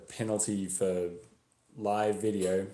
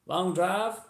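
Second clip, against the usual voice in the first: higher-pitched Texan stranger offering help; friendly passing driver; 11 semitones higher, 4 dB louder than usual. Acted. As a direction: ask helpfully, voice lifting high. Friendly driver makes small talk.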